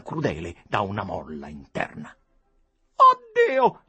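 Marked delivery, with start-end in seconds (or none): 2.9-3.8 much higher, much louder cry of horror; horrified cry. A narrator's reading. Lady cries out in horror at the dying man. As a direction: shriek at the sight. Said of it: "gemette donna Adelaide".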